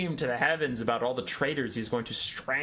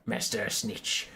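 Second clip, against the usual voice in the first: appeasing rat minion. Acted. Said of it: "and goes".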